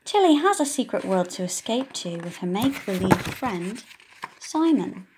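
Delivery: ordinary delivery, nothing marked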